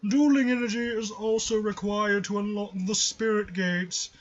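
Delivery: slow, grand pompous villain. Pretend. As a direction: boom it out like a self-important master. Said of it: "a kind of a pompous voice".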